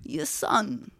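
relaxed, lightly voiced attempt at the impression; Walken. Shown as a demonstration. Pupil easing off the line, Walken impression. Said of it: "more laid back with it".

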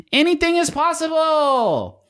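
long, high-pitched holler; soaring slow cry, far above normal pitch; mock yelling. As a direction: yell it like a triumphant commercial voice, drawn out. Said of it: "that was my voice yelling".

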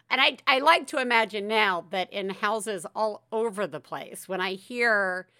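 ordinary talk, nothing marked

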